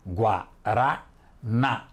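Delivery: "Guaraná" drawn out slowly, gua-ra-NÁ; separado em sílabas. Shown as a demonstration. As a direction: stretch out "Guaraná" and stress the final NÁ. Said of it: "Vamos separar as palavras em sílabas". ("Guaraná" devagar, com força no NÁ)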